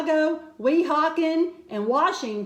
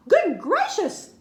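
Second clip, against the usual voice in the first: higher gasp of shock; gasped surprise; 5 semitones higher than usual. A narrator's reading. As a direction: caught off guard, gasping. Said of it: "gasped Maisie".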